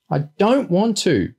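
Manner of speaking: put-on high, slow child's stubborn refusal; firm refusal. A narrator's reading. Small boy refusing to be carried down, firm. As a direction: dig in like a small boy, drawing it out. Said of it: "he repeated firmly".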